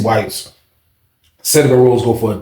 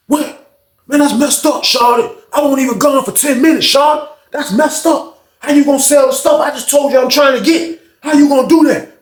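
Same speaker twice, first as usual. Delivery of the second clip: upset yell; 13 semitones higher than usual. Retold or imitated. Fred yelling at the old head downstairs, upset. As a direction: call him out, betrayed and heated.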